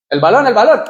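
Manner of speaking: high, loud, sudden warning; aviso urgente. Acted. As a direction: call out a quick alert about the ball. Acts out warning of a ball. (grito de aviso repentino)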